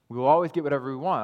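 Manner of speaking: fast scornful echo; quick mocking repeat. Shown as a demonstration. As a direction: rattle off fast, mocking the false teaching. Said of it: "I'm not making that up".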